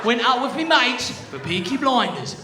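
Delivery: put-on loud, high-pitched British accent; hammed-up British lad, pitched high and loud. Patient telling doctor about the remote, British accent. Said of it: "I'm just gonna get it back quickly".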